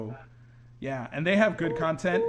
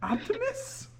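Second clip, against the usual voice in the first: squeaky mock call; 4 dB quieter, slower than usual. Squeaky Transformers joke.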